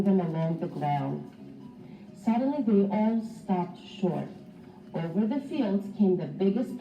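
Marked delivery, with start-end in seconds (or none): none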